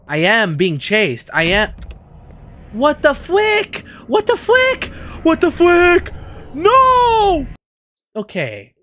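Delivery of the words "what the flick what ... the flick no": high, loud frightened yelp; panicked shriek. Real, not acted. Gamer shrieking while being chased. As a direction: scream as you're chased.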